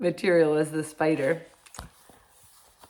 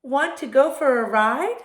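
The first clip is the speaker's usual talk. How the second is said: higher, louder horse inviting a friend; horse character; 8 semitones higher, 7 dB louder than usual. A narrator's reading. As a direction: call out an eager invitation as the horse. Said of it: "said the horse".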